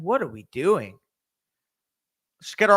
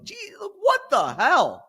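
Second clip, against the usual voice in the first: high, slow, drawn-out disbelief; exasperated disbelief; much slower than usual. Real, not acted. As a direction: drag it out in disbelief. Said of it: "I had to cuss that out".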